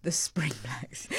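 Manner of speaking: whispered slowly, "Spring Blacks" breathed out as a self-mocking blunder; embarrassed whisper. Real, not acted. Presenter repeating her own slip to herself, whispered. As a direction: hush the wrong name as if caught out. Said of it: "Oh, it was going so well".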